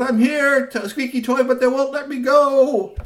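high, loud, slow call; a kid held back, pleading; child's cry. A narrator's reading. Three T calling to Squeaky Toy, high pleading. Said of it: "So Three T said".

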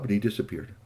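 usual speaking voice

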